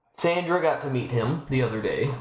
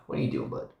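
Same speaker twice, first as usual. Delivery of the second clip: whispered to the kitten; soft whisper; 11 semitones lower, 6 dB quieter, faster than usual. Real, not acted. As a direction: hush it low to the kitten.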